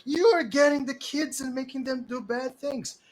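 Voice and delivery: loud, high-pitched scolding; heated, indignant outburst; angry accusation. Acted. Acted man accusing during a fight.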